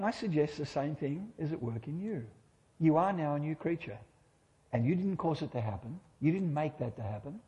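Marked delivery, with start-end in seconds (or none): none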